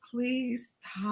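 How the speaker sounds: soft, pleading under the breath; quietly begging him to stop; hushed plea. Retold or imitated. To her uncle, embarrassed, a soft hushed plea. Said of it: "and I was like".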